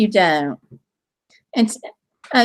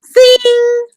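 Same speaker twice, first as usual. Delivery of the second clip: playful zing; 11 semitones higher, 4 dB louder than usual. Real, not acted. Cheering a commenter's joke.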